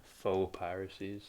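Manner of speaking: Faux said softly and slowly as foh; correct pronunciation. Real, not acted. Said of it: "fake conspiracies when I wrote faux".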